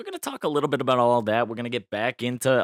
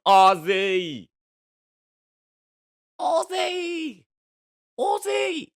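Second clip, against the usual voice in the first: rising higher each time, a squealed Aussie; Sharon Osbourne impression; 7 dB louder, much slower than usual. Shown as a demonstration. Vlogger trying a Sharon Osbourne voice, shrieking Aussie. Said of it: "my Sharon Osbourne impression".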